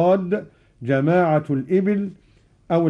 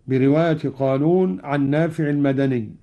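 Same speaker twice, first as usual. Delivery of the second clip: ordinary delivery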